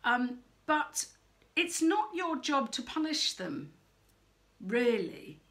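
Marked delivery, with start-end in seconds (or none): none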